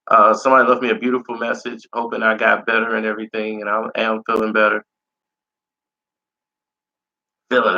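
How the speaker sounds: slightly raspy, recovering voice; a bit of a raspy voice. Real, not acted. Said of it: "You can tell I have a bit of a raspy voice".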